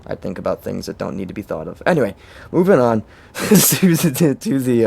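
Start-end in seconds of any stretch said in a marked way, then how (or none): 1.9-4.9 laughing. Real, not acted. Laughs mid-sentence moving on.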